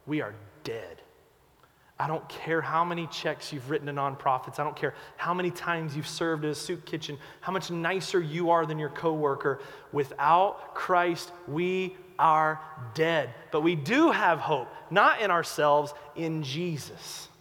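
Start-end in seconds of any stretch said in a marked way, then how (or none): 0.1-1.0 soft, low and grave; preacher softly tells the congregation they are dead; hushed. Real, not acted. Preacher drops to hushed verdict. 9.9-13.3 drawn out slow and grave; preacher slowly stresses his point to the congregation; slow, solemn. Real, not acted. Preacher slows for emphasis.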